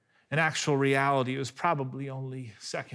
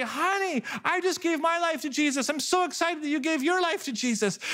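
much higher, beaming and eager; excited; 14 semitones higher, 3 dB louder than usual. Retold or imitated. Husband skipping back to tell his wife, thrilled and high. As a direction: gush with joy to your wife. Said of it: "excited to say".

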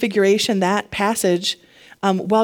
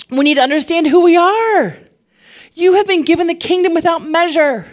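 raised, high-pitched preacher's exhortation; impassioned preaching; 8 semitones higher, 6 dB louder than usual. Real, not acted. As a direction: drive it home to the congregation, voice lifted. Preaches at full force.

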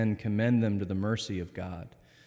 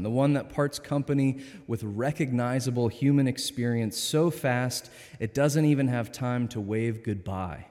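ordinary talk

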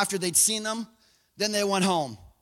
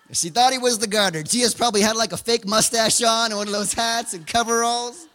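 usual speaking voice

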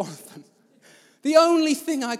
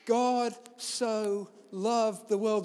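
slow emphatic; much slower than usual. Shown as a demonstration. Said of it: "quoting the verse slowly".

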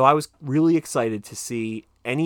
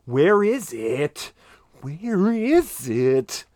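mock searching; 5 semitones higher, 5 dB louder, much slower than usual. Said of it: "patting all my pockets and being like".

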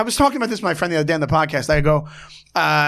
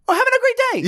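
very high, squeaky fake cheer; mock flustered; much faster than usual. Comic showing how that would throw him, squeaky and flustered. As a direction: chirp it like someone thrown off. Imagined startled reaction, not tough.

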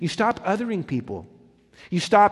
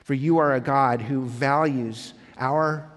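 prayer; 4 semitones lower than usual. Acted. Pastor leads prayer.

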